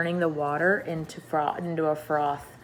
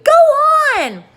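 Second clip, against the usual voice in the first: much higher, louder frantic shriek; frantic scream; 12 dB louder than usual. A narrator's reading. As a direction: scream at him to go on. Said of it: "they cried frantically".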